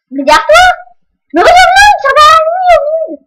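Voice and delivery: high, loud yelling warning; frantic shout. Real, not acted. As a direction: scream the callout. Teammate warning in gunfight.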